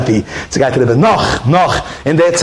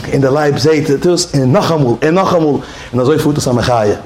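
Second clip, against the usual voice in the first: usual speaking voice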